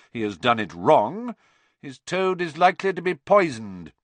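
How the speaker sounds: high, deliberate, theatrical teacher; menacing Snape. Acted.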